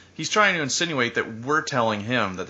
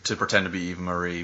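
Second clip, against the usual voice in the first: usual speaking voice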